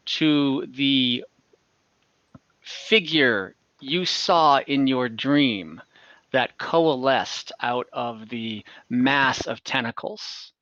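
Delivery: ordinary talk, nothing marked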